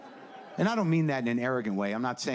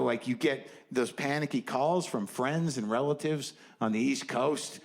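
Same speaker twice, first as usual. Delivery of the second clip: usual speaking voice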